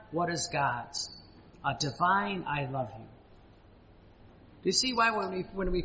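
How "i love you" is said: soft, low and tender; tender I love you. Acted. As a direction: breathe it out gently like a whispered vow. Preacher voicing God's tender love.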